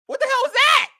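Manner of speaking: very high, loud disbelief; outraged yell. Real, not acted. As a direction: yell in frustration at the quarterback. Reacting to the interception.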